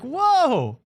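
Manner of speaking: shocked whoa. Retold or imitated. Shocked reaction to a story.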